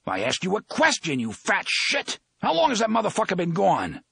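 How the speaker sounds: loud, furious roar. Acted. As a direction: roar it at the fat man. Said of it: "roared at the man".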